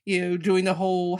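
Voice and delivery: ordinary talk, nothing marked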